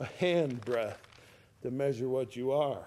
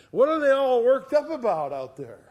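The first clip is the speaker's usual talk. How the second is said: exclaiming question; 9 semitones higher, 9 dB louder than usual. Acted. Preacher voices the congregation asking.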